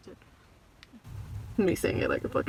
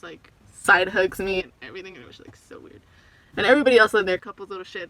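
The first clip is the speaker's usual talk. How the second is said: ordinary delivery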